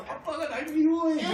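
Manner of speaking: put-on high, slow, laughing kid voice; whiny child. Toddler complaining about dad, high and whiny through laughter. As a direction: whine it like a sulking toddler. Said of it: "이거밖에 기억을 못한대".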